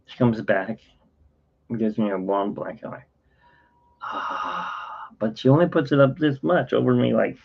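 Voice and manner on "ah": long, soft voiceless sigh; sigh of relief. Retold or imitated. Patient getting a warm blanket, soft relieved sigh. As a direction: breathe out in mimed relief.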